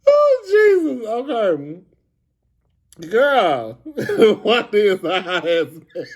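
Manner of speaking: high gasp settling into chuckles; real laughing. Real, not acted. Trying to get herself together over Wanda, still laughing. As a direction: fight to recover, then crack up. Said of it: "I'm already laughing".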